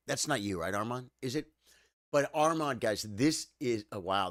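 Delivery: ordinary talk, nothing marked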